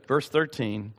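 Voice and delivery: ordinary talk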